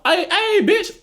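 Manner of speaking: high, bright-pitched mock girlfriend call; imitating girls greeting. Showing how some women greet each other, high mock call. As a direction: squeal it like a friend greeting her girl. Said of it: "females who talk".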